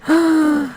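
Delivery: sharp, high-pitched gasp; excited gasp. Real, not acted. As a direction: gasp in real delight. Reacting to wrestler appearing on screen.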